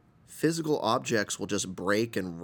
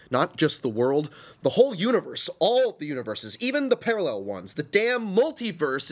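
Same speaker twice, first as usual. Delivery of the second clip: rising higher and louder each phrase; building excitement; 5 semitones higher, 4 dB louder than usual. Acted. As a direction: build it up to the multiverse. Rant builds to cosmic scale.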